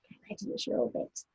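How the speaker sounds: soft quick whispered cue. Real, not acted. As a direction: whisper so the audience won't hear. Teacher cues child actor mid-story.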